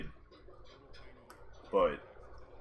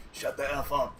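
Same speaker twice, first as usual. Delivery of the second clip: mock drill instructor; 6 semitones higher, 4 dB louder than usual. Drill instructor barking orders.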